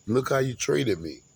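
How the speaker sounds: ordinary talk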